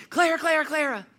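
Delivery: high, loud repeated name; calling to interrupt. Retold or imitated. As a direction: break into a child's chatter. Breaking into child's long chatter.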